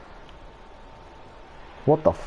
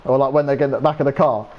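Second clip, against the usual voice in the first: ordinary delivery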